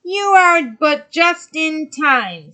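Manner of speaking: high, loud, slow witch voice; sea witch voice. Acted. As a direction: loom over her as the sea witch. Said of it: "said the witch".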